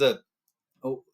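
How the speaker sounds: normal talking voice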